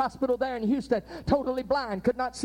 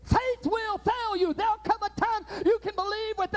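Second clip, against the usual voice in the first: preaching cry; 12 semitones higher, 3 dB louder than usual. Real, not acted. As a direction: stretch each word out like a preacher's cry. Same point repeated, preaching climax.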